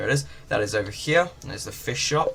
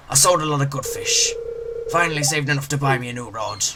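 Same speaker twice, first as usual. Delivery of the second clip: a light, higher salty sea-dog voice; little pirate voice; 3 semitones higher than usual. Acted. Gamer voicing the fisherman greeting the newcomer as a pirate. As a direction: growl it out like a friendly old pirate. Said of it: "Little pirate voice".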